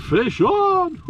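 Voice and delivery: loud high call, then laughter; excited shout. Real, not acted. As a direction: shout it out with joy. Another fish hooked.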